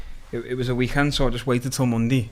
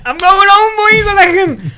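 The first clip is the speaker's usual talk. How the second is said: much higher and louder, swaggering; cocky boast; 13 dB louder than usual. Retold or imitated. Defendant boasting to the other lads, loud and cocky. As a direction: crow it to the lads, sure you're free.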